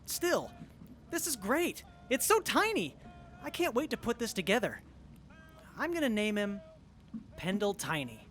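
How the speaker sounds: high, thrilled; delighted. Acted. Friend delighted by a tiny robot kit, high and gleeful. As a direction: gush over how tiny it is.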